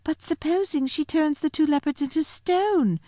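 put-on high-pitched anxious girl, not fully whispered; high, hushed-style child's question; whispering little girl. A narrator's reading. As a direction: lift the voice high like a worried child. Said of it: "whispered Lucy to Peter".

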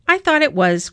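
ordinary delivery

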